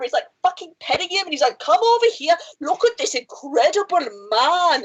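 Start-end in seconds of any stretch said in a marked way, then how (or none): 1.6-4.8 put-on higher, slipping Austrian accent that drifts Scottish; slow mock Arnold, accent wandering off; botched Schwarzenegger accent. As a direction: Austrian accent gone wrong, near Scottish. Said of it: "Arnold Schwarzenegger suddenly turns Scottish".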